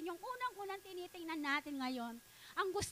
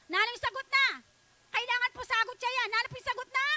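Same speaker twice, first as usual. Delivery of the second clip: urging the congregation; 6 semitones higher, 7 dB louder than usual. Acted. Preacher urging answer.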